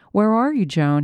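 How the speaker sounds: usual speaking voice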